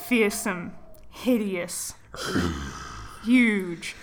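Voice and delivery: lightly spooky, drawn-out list; a hint of ghost story. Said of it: "spooky voice".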